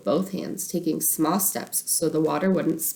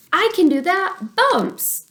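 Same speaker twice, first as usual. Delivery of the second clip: put-on much higher, louder eager little-girl call; 13 semitones higher, 5 dB louder, slower than usual. Little sister volunteering as bump spotter, calling out. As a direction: shout it out proudly like a tiny lookout. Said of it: "she called out to show how serious she was".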